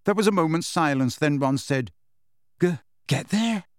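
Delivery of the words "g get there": higher, stumbling echo question; stammering disbelief. A narrator's reading. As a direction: stumble on the first word, taken aback.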